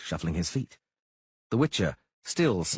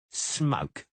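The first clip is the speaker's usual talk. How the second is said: taunting sneering bodyguard; Cicada voice; 6 semitones higher than usual. Acted. Cicada answers.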